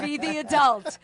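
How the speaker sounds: 1 coach. Retold or imitated. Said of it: "He'd coach me".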